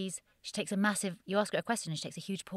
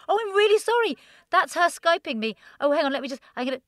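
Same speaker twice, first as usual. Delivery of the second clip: mock flustered apology; 10 semitones higher, 11 dB louder than usual. Pretending interview interrupted by call.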